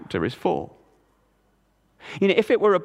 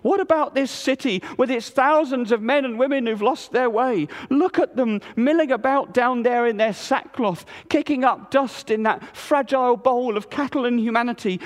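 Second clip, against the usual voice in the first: higher, passionate appeal; God pleading; 9 semitones higher than usual. A narrator's reading. God asking Jonah about Nineveh, impassioned. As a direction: press the question home with feeling. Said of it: "as the torrent continued".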